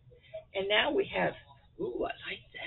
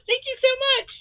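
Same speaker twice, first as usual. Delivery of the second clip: high, loud, gushing thanks; excited thanks; 8 dB louder, much faster than usual. Real, not acted. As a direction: beam it out with joy. Reading card, thanks loudly.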